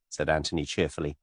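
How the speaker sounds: ordinary delivery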